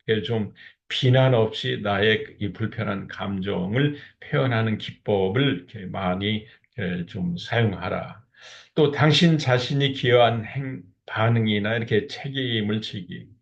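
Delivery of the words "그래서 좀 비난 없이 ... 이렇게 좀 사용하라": ordinary talk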